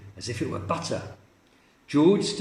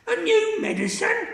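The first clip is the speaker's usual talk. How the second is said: Grandma; 3 dB louder than usual. Acted. Grandma scoffing.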